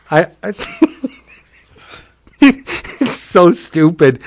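real giggles breaking up the words; laughing while talking. Real, not acted. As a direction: crack up as you try to speak. Cracking up at the story.

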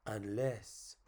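low, soft "Unless", tailing off; musing aside. Acted. Clown considering the puppet's wish, low and soft. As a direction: murmur "Unless" as a thought forming. First of repeated teasing take.

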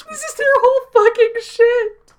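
very high, loud exclamation; exasperated outburst. Real, not acted. Exasperated outburst about CIA hypocrisy.

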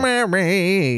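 high, hammy trombone-like call. Retold or imitated. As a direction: honk it like a cheap jingle singer. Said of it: "that shitty, like, trombone voice".

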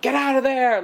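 high-pitched, loud mock yell; yelling at the screen. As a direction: play a viewer yelling at the movie. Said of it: "you might be yelling at the screen".

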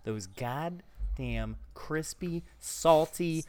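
slow, relishing host pitch; hype host. Acted. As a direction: savor every adjective like an ad. Hyping fries in show voice.

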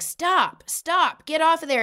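high, bossy little-sister warning. Retold or imitated. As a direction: imitate a kid ordering a sibling off. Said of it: "she was being kinda bossy".

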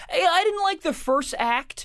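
high, slow complaining audience member; mock theatre heckler. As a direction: call out to the stage as a clueless patron.

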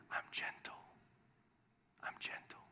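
breathy unvoiced whisper, very soft. Shown as a demonstration. Preacher whispering God's voice to the church. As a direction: hush it like a secret in the heart. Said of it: "It was like a whisper".

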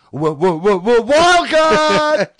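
stuttered build-up to a high, drawn-out shout; triumphant announcement. Pretend. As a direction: wind up, then yell it in mock triumph. Joke announcement of playing a wild card.

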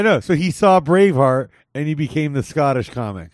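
ordinary talk, nothing marked

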